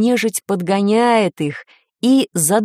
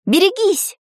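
high, urgent shout of alarm; warning cry; 11 semitones higher than usual. A narrator's reading. Girl warns of fire.

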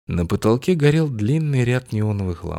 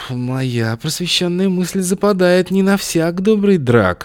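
higher, louder, slow mincing show-off voice; affected club slang; 10 semitones higher, 6 dB louder, slower than usual. Acted. Augustin trying to pass as a club regular, mincing high drawl. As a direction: camp it up, drawn out and high. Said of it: "стараясь кривляться как можно естественнее".

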